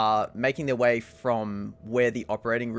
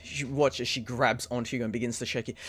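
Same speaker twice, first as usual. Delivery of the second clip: normal talking voice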